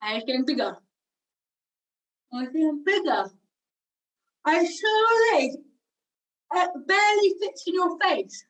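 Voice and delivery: exaggerated chewing, voice climbing as the gum grows; pretend chewing gum. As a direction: talk round an ever-bigger wad of imaginary gum. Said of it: "imaginary piece of chewing gum".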